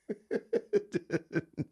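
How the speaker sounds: real burst of high laughter; bursting out laughing at the memory. Real, not acted. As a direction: crack up helplessly. Laughing at the story.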